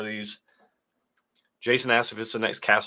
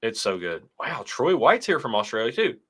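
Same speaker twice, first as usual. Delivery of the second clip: usual speaking voice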